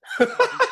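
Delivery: high, bright burst of laughter at his own joke. Real, not acted. Laughing at his own wife joke.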